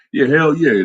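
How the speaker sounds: hype agreement. Real, not acted. Hyped-up yes to the viewer calling it cap. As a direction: burst out in eager agreement.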